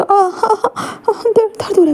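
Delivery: high, strained pained crying. Acted. Shot Wing tells Wang Ji it hurts, high pained cry. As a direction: cry out through the pain.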